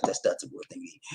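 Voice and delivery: unvoiced whisper aside. Real, not acted. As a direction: whisper it to yourself. Aside about rice.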